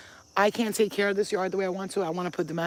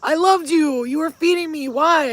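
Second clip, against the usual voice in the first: high, loud, wounded plea; acted anguish, higher and louder; pleading cat voice; 8 semitones higher, 7 dB louder than usual. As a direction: voice a betrayed cat crying out why. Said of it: "I could hear her saying".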